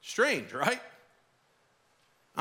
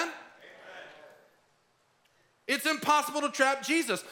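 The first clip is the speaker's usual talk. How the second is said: full-force preaching; 8 semitones higher than usual. Acted. Pastor proclaiming to the congregation that Jesus cannot be trapped, high fervent preaching.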